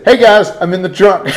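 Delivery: calling out. Retold or imitated. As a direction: imitate someone shouting from a hiding place. Retold call from the trunk.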